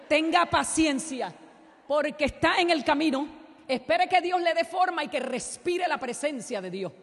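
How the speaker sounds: loud, high, slow exhortation; preaching shout. Acted. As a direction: urge the single women to be patient. Preaching God's advice loudly. (predicación fuerte y lenta)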